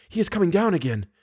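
quick, high, hushed alarm; sudden exclamation. Acted. Woman spots him on the stairs, exclaiming suddenly. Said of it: "Suddenly she exclaimed".

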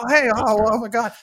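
high, loud, breathless retold excitement; imitating himself losing it with joy; retold freak-out. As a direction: relive the moment the big name called. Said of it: "freaking out in my, in my bedroom".

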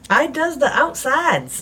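high, slow character voice. Acted. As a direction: raise the pitch and slow down. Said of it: "And that's my character voice".

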